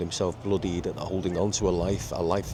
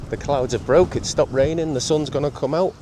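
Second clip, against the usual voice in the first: louder, higher, glad announcement; excited; 6 semitones higher, 5 dB louder than usual. Real, not acted. Vlogger telling viewers the rain stopped, excited. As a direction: cheer the sun coming out. Weather clearing, repeated.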